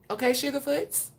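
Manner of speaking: a bright, high sing-song sign-off; switched-up energy. Shown as a demonstration. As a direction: sing Okay, Sugarfoots up high. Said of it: "Thought I'd switch it up".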